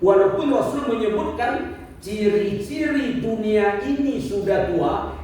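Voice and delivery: normal talking voice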